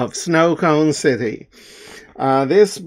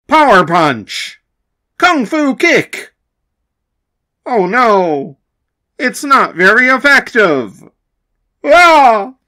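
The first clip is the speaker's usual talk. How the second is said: louder shouted attack names, ending in a yelp; attack shouts; 6 semitones higher, 5 dB louder than usual. Acted. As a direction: shout each move, then cry out. Attack names, cry of pain.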